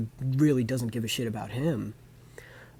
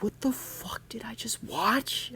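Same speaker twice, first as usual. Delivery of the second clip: high, breathless whisper of disbelief; stunned whisper; 11 semitones higher, 4 dB quieter, slower than usual. Retold or imitated. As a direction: whisper it, shocked by the ending. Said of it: "and I'm like".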